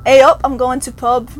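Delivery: Canadian-flavoured try at Yorkshire. Shown as a demonstration. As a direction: attempt at Yorkshire A up, I'm going to pub. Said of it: "You still hear that, like, Canadianism".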